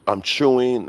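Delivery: ordinary talk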